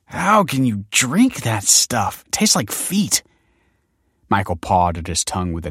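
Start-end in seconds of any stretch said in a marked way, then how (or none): 0.1-3.2 high, drawn-out disgusted protest; appalled, high-pitched splutter; spluttering dismay. A narrator's reading. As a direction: splutter in dismay after a foul drink. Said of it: "then spluttered in dismay".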